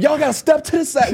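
high imitation of crowd-clearing; lady clearing path. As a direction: call people aside as the lady.

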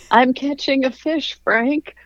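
high-pitched imitated wife; wife's retort. Wife answers alarmed husband.

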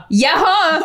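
playful schoolkid "yeah-huh"; retold contradiction. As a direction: insist back, laughing. Retold childish quarrel over lumpia.

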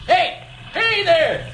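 high hail across the water; calling out. Acted. Fisherman hailing a drifting boat, calling out high. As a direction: call out to the stranger in the boat.

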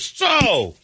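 mock excitable date; excitable woman. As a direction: sing out the question eagerly. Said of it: "and she goes".

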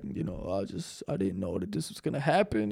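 tearful confessional to a camera; mock teary confession. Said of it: "with tears in your eyes".

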